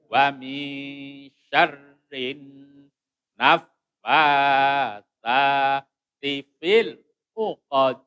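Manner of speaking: slow chant, n hidden and held in "min syarrin"; drawn-out model recitation ending firmly on "uqad"; ikhfa recitation. Shown as a demonstration. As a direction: recite loudly and slowly, humming through the n of "min". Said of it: "Ikhfa lagi".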